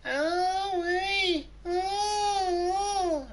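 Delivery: very high squeaky cries of a cartoon girlfriend; Olive Oyl falsetto. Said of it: "the Hulk sounds like Popeye's girlfriend".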